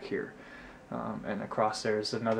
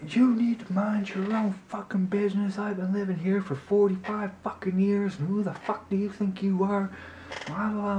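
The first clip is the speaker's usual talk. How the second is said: high, shrill-free screeching rant, pitched well up; angry old neighbour screaming from her doorway; mock furious yelling; 9 semitones higher than usual. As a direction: play the furious neighbour tearing into him, voice way up. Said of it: "she starts yelling".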